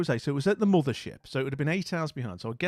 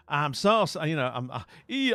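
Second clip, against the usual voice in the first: usual speaking voice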